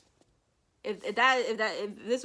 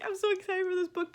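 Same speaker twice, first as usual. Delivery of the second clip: self-mocking gush; 12 semitones higher, 6 dB louder than usual. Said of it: "I know I keep being like".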